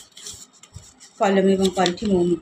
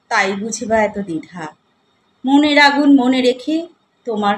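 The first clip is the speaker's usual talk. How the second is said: poem recitation; 3 semitones higher, 5 dB louder than usual. Acted. Poet tells of living alone, recited as expressive verse. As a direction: recite in measured phrases with pauses between them.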